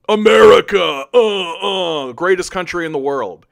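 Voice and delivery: shouted "America", then a dazed mock patriot. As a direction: parody a brainwashed flag-waver, loud start. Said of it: "you're just sitting there".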